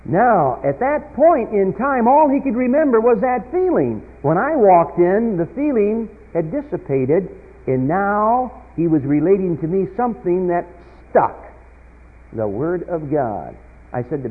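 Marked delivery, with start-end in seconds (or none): 0.1-11.4 loud, ringing pulpit delivery building to peaks; preaching at full force. Acted. Preacher telling his congregation a conversion story, preaching at full force. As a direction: preach it out to the back pews.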